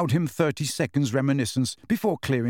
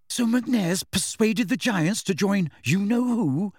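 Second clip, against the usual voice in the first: desperate; 7 semitones higher than usual. A narrator's reading. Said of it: "said Hermione desperately".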